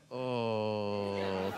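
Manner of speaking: low, soft held-in groan; repressing the urge to shout at the twins; stifled groan. Retold or imitated. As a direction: swallow the shout. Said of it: "I try and contain it".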